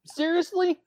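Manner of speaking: high disbelieving squeak; incredulous. Retold or imitated. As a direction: act shocked at the question. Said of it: "And he's like".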